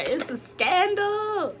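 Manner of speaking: high, slow, hammed-up gossip voice; mock scandalized. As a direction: relish the scandal, drawing it out. Gossipy delight at the reading.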